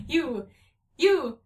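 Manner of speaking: halting stammer, rising. A narrator's reading. Cat stammering in horror at the broken child. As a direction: stutter out the words in shock. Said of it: "Rumpelteazer stammered".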